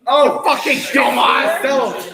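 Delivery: loud laughing yell at self; shout. Real, not acted. Player realizes, shouts at self.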